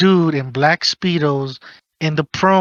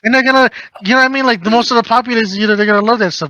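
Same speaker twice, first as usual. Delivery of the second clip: excited rush; 6 semitones higher, 4 dB louder, much faster than usual. Real, not acted. Excited rant.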